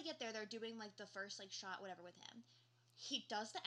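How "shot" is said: ordinary delivery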